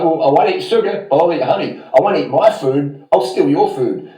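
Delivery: scornful mock of smug human logic. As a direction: sneer at the hypocrites. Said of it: "we're like".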